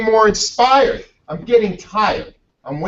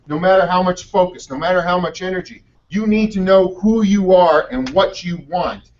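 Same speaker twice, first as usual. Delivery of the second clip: ordinary talk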